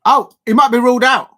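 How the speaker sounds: surprised. Real, not acted. Streamer spotting the goal may be ruled out, surprised cry. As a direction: jolt up at the offside flag.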